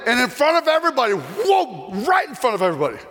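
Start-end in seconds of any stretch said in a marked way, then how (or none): none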